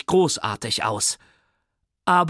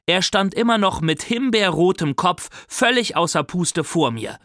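ordinary delivery

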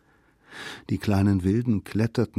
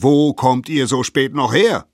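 loud, higher indignant question; scolding; 6 semitones higher, 7 dB louder than usual. A narrator's reading. Elders meet the children at the cave, scolding. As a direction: tell them off for being late. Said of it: "schimpften sie".

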